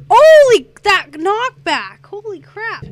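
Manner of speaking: loud high holy, slow stretched knockback; excited yell. Real, not acted. Gamer shocked by knockback in game, loud high yell. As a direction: shout it out amazed at the knockback.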